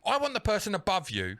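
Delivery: high, loud irate customer; mock angry demand. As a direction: demand a manager, hammed up. Acting out angry call demanding a manager.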